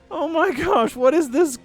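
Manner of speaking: normal talking voice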